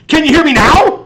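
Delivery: imitated furious shout, high and loud; pissed off. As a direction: yell it as an exasperated mic check. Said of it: "this is him just being pissed off".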